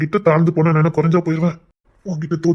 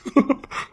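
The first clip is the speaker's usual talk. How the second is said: airy laugh; 7 semitones higher than usual. Real, not acted. Husband laughs at teasing.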